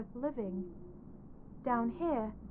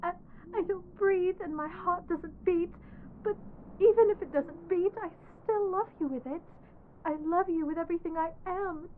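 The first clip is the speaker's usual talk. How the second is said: tearful declaration; 3 semitones higher than usual. Acted. Dead bride begs her groom to come out, crying. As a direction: pour out love while close to sobbing.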